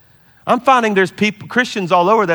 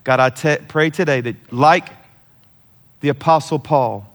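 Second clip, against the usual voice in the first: closing prayer; 4 semitones lower than usual. Acted. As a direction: pray over them gently, lingering on words. Closing prayer over church.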